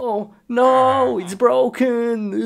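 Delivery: high, drawn-out wail; mock dismay. Gamer finding the computer broken, wailing in mock dismay. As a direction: lament it with hammed-up distress.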